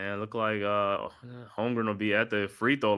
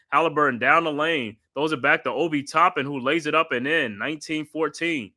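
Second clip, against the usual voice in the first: steady broadcast play-by-play, calling each pass; 4 semitones higher than usual. Acted. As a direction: call the game like a TV announcer. Live play-by-play call.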